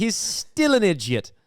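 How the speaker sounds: mock British accent, slow; lightly done British put-on. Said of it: "with a British accent".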